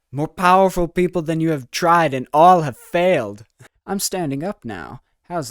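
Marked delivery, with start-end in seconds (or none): 0.1-3.4 mock villain. Boasting about green screen powers.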